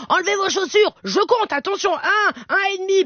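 high-pitched mum giving a countdown warning; mother scolding children. Retold or imitated. Mother telling her children to take shoes off, high scolding. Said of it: "Quand je dis à mes enfants".

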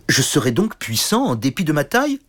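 mildly amazed question; astonished little knight. Acted. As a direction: wonder aloud. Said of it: "fut étonné". (petit chevalier étonné, un peu)

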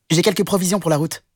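quick high rabbit; little rabbit voice. A narrator's reading. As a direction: rabbit showing off his carrots. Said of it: "dit le lapin".

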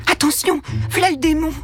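servants shrieking a warning; alarmed warning. A narrator's reading. Servants warn of the child.

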